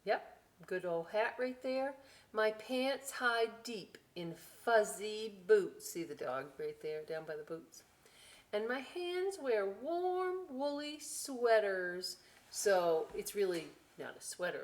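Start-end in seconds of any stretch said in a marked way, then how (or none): 2.3-5.9 put-on slow, lively read-aloud to children; storybook voice, slow and bright; story reading. A narrator's reading. Reading book. 8.5-12.2 put-on slow, lively read-aloud to children; storybook voice, slow and bright; story reading. A narrator's reading. Reading book.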